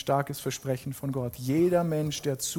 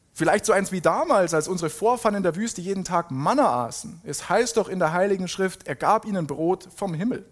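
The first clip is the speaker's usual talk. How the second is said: higher, animated reading with emphatic key words; dramatic scripture reading; 4 semitones higher than usual. Acted. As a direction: read the Bible dialogue out with conviction. Dramatic Bible reading amid own commentary. (lebhafte, betonte Bibellesung)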